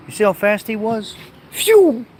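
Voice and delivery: high, loud amazed squeal; excited squeal. Real, not acted. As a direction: gasp it out, bowled over by his speed. Amazed at the dog's speed.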